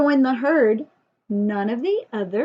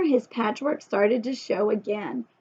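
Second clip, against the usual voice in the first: plain reading voice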